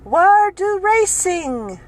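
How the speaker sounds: high, slow, exasperated broken English; angry and confused. Retold or imitated. Angry customer repeating her question to the cashier, high and slow. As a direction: mimic her frustrated repeat. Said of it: "angry and confused".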